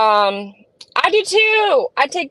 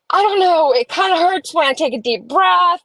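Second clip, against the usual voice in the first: retold worry; 6 semitones higher than usual. Retells own worried answer to partner.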